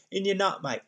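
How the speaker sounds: lightly put-on Australian accent; a hint of Australian twang; mock Aussie bloke. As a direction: tap your head, Aussie style. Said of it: "I'll put that accent on".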